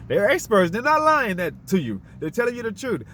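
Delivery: mock the blind faith in experts, loud; sarcastic.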